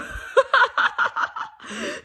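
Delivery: real high breathless laugh; airy laugh. Real, not acted. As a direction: wheeze with laughter. Laughing about German rappers.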